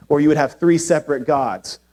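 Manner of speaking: ordinary talk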